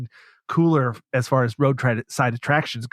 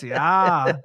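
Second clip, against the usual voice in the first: lightly mocked Minnesotan, pitched up; a hint of playful Minnesota accent; 4 semitones higher than usual. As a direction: imitate a drawn-out Minnesota "yeah". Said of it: "He talked like this".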